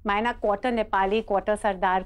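usual speaking voice

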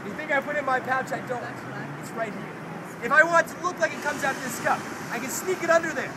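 ordinary talk, nothing marked

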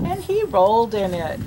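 ordinary talk

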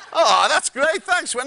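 laughing. Retold or imitated. Amused guest replies.

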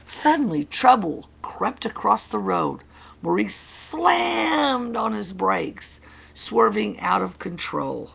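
animated storyteller at a moment of trouble; dramatic story reading. Acted. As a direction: read it big for children, stretching the action word. Reads picture book to children.

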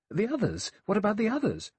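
high, urgent questioning; agitated. Acted. Vera demanding an answer from Miss Brent, agitated. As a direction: press the question, flustered.

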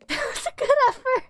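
high, loud laughing words. Real, not acted. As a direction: squeeze the praise out through laughter. Laughing at the dog's failed catch.

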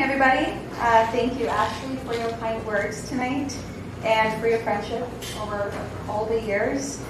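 usual speaking voice

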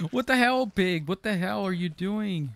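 high, startled yell at pig; startled outburst. Real, not acted. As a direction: shout in surprise, high. Yelling at pig in game.

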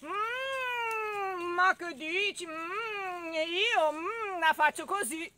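long moos between the words; mooing cow. Acted. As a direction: moo through the denial.

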